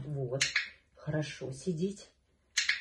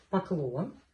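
usual speaking voice

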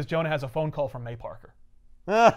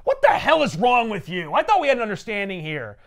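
raised, menacing tirade; angry threat; 4 semitones higher, 4 dB louder than usual. Retold or imitated. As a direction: lean in and threaten him. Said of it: "he smashes it and he says".

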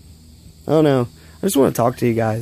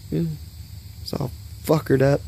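normal talking voice

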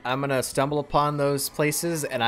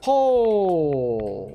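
a sudden, louder high "Oh!"; surprised realization; 4 semitones higher, 4 dB louder than usual. Real, not acted. Gamer piecing together the Swan's story, surprised. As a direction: it suddenly clicks.

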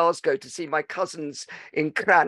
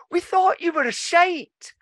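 put-on high child voice, deadpan put-down; wee cousins; 12 semitones higher, 4 dB louder than usual. Cousins cutting their uncle down, high child voice. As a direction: mimic a kid's blunt verdict.

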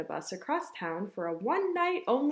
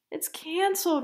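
higher-pitched dismayed wail; mild wail; 6 semitones higher than usual. Acted. Ava's mother laments canceled story time, mildly wailing. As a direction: lightly wail the bad news. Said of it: "wailed Ava's mother".

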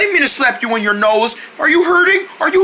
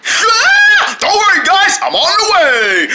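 excited rescue call; 8 semitones higher than usual. Pretend. Rushing to help.

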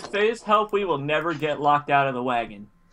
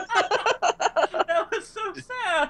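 laughing; much slower than usual. Real, not acted. Laughs at dead rabbit.

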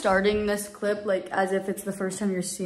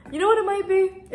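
sudden idea; 11 semitones higher, 6 dB louder than usual. Real, not acted. Sudden realization.

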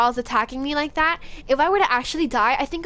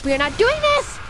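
panicked shout; 11 semitones higher, 5 dB louder than usual. Real, not acted. Panicked refusal during attack.

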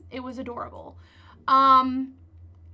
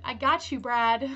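ordinary talk

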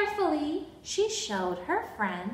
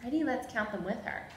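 ordinary delivery, nothing marked

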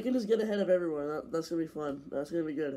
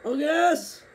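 excited shout; 4 dB louder than usual. Real, not acted. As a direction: yell it out in triumph. Big overtake in race.

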